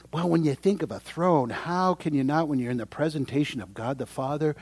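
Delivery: usual speaking voice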